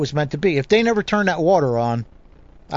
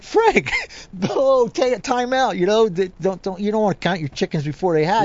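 high, laughing, wound-up protest; excited protest; 6 semitones higher than usual. Retold or imitated. Angler telling Frank to hold off, excited and laughing. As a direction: laugh it off but insist, all keyed up. Excited winner refusing to celebrate.

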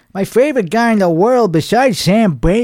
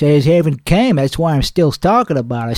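usual speaking voice

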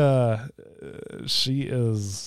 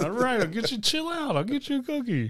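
mock exasperated parent; 12 semitones higher, 6 dB quieter than usual. Parent answering demanding toddler.

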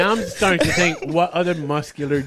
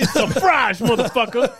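high, loud mock catchphrase; guy from Dexter; 7 semitones higher, 4 dB louder than usual. Comic echoing a friend's TV quote, high punchy impression. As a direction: pounce on it like a TV tough. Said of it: "that guy from Dexter".

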